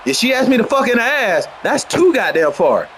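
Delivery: ordinary talk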